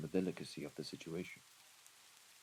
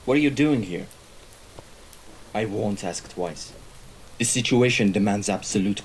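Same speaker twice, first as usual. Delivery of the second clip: raised, higher voice confronting an intruder; loud, demanding interrogation; sharp challenge; 4 semitones higher, 4 dB louder than usual. Acted. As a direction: challenge the trespasser, building in force.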